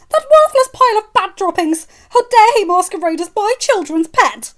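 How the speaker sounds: screaming rage, very high and loud; rant, spitting fury at the rat; furious rant. Acted. Mother ranting in fury.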